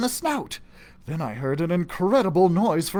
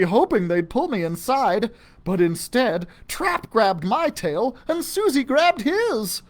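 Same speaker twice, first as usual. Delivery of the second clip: usual speaking voice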